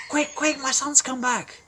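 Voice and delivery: excited father calling out; urgent happy orders. Acted. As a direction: rush the servants, beaming with joy.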